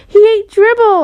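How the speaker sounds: loud, high insistence; insisting. A narrator's reading. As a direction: insist hard to mom. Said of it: "I insisted".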